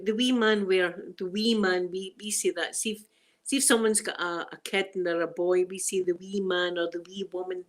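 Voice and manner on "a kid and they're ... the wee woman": ordinary talk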